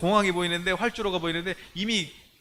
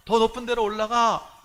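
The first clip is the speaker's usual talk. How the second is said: 더 높은 데로 올라가 pushed higher and louder the second time; rising retold shout; louder repeated call; 6 semitones higher, 6 dB louder than usual. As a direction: urge the kids up again, more insistently. Shouting to children in dream.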